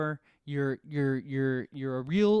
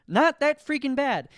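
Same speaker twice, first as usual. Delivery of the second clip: emphatic; 8 semitones higher, 7 dB louder than usual. Real, not acted. Hot take rant.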